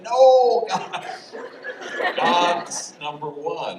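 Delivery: high, loud, laughing through the words. Retold or imitated. Priest laughing at homie's God answer. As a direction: crack up while answering. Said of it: "we just howled".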